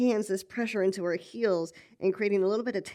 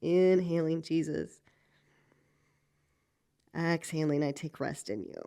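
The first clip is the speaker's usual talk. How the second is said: slow breath prayer. Shown as a demonstration. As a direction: breathe the words out slowly. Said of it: "A few more breaths".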